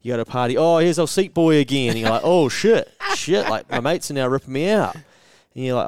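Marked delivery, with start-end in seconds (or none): 0.6-1.9 higher and louder, jeering mates; mocking tease. Party mates teasing the chair seller, loud mocking jeer. 2.2-5.0 higher and louder hammed-up alarm; mock panic. Seller mocked by mates, hammed-up loud panic.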